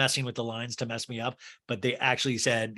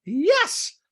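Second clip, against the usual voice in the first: high, loud, exasperated parody; hammed-up outraged squawk; mock shrieked yes; 6 dB louder than usual. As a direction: screech it like an offended patron. Doreen snaps at Kathy.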